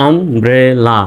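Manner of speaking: "Umbrella" broken into am, bre, la; teacher sounding out "umbrella" in parts; syllable by syllable. Shown as a demonstration. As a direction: split "umbrella" into three distinct syllables. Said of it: "যেভাবে আমি ভেঙে ভেঙে উচ্চারণ করতেছি".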